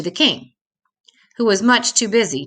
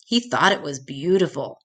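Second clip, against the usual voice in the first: ordinary delivery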